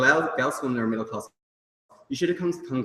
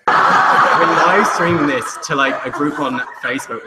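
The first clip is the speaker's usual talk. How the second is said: slow, louder, laughing as you explain; 2 semitones higher, 10 dB louder, slower than usual. Real, not acted. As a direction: share the joke with the room. Laughing aside to audience.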